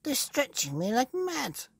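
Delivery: put-on very high, excited old woman's voice; Mrs Twit crying out. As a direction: shriek with glee at the stretching. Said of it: "cried Missus Twit".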